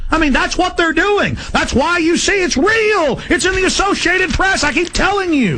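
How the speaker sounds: high-pitched insisting; insistent high pitch. Real, not acted. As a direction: push the point, pitch way up. Insisting loudly.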